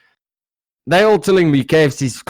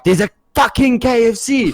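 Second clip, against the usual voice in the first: raised, high-pitched arguing; mock angry insistence; 11 semitones higher than usual. Arguing with a friend about K F C, mock angry. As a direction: insist as in a play fight. Acted drunken argument over food.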